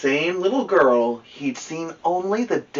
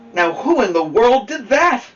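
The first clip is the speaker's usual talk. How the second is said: high, loud startled exclamation; exclaiming watchman; 11 semitones higher, 6 dB louder than usual. Acted. Watchman finds the crash, loud high exclaim. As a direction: call it out like a startled night watchman. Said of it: "he exclaimed".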